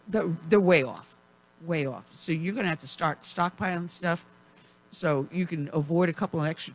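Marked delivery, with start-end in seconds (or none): none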